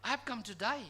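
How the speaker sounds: "die" with a wide eye vowel, meant as "today"; "to die" in place of "today"; Australian "today". Retold or imitated. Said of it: "it sounded like".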